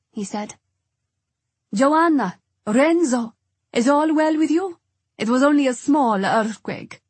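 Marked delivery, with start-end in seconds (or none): 1.7-7.0 raised voice, pitched up; called loudly from the doorway; calling out. Acted. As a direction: call to them from across the house. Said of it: "Paola called from the open door".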